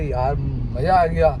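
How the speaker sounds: low, slow contented father; quoting father. Retold or imitated. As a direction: savour it slowly as dad would. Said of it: "पापा बोले".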